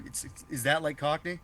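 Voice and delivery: ordinary delivery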